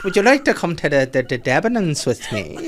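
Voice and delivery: mock-Irish invitation, breaking into laughter; bad Irish accent. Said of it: "worst Irish accent I've ever done".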